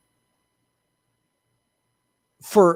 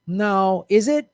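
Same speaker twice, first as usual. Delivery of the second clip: ordinary talk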